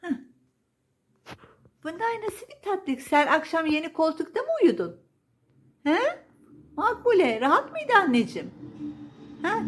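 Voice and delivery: high, cooing pet-talk voice; baby talk to cat. Acted. Owner cooing to her cat, high baby talk. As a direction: gush over the cat like a baby.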